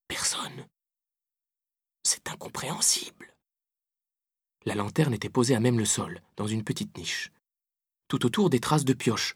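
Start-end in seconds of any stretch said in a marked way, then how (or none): 0.1-3.3 soft, baffled whisper; puzzled whisper. A narrator's reading. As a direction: whisper in disbelief, careful not to be heard. Puzzled, creeping in the crypt.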